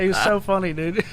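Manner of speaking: normal talking voice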